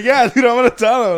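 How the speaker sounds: high, loud mock quote; imitating co-host. Podcaster imitating his co-host about telling it, loud and high. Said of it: "he's just like".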